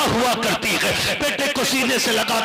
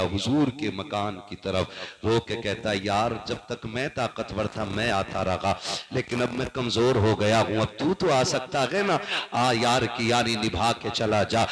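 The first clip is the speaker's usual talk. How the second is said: low crying plea; 11 semitones lower, 5 dB quieter than usual. Acted. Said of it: "बच्चे ने अब रोना शुरू किया".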